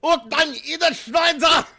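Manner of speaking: high, very loud German outburst; retold German shout. Retelling what he was saying, high shouted German. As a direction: yell it in mock German fury.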